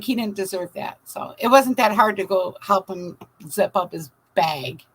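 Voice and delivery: ordinary delivery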